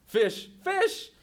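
high loud yelp; panicked yell. Acted. Van ploughing through a fish stall, panicked yell. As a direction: cry out in alarm.

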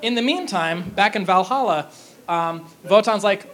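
normal talking voice

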